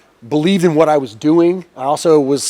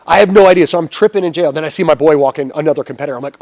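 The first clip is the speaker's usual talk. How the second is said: normal talking voice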